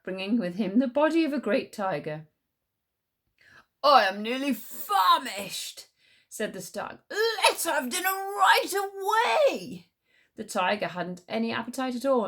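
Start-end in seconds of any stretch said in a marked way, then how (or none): 3.8-5.9 loud, slow hungry stag. Acted. Stag voice in story. 7.1-9.9 high, slow, crying-out stag; menacing stag. Acted. Stag voice in story.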